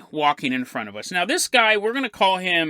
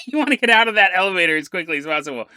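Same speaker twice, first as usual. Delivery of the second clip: plain reading voice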